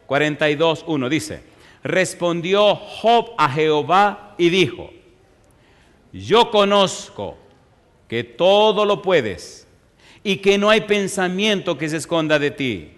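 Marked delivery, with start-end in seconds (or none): 1.8-12.9 slow, loud, higher Bible reading; slow declaiming of scripture; solemn scripture reading. Acted. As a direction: proclaim Job's words slowly from the pulpit.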